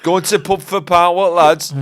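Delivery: put-on Huddersfield accent. Rounding up lads for pub, Huddersfield accent. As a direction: echo the broad Huddersfield invitation. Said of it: "we're doing the English".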